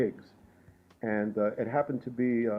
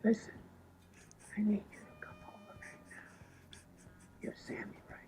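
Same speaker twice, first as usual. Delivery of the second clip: soft hoarse whisper, much quieter; Miles's whispery voice; 12 dB quieter than usual. Retold or imitated. Miles calling at 2:30 am, whispered and hoarse. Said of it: "I hear this voice go".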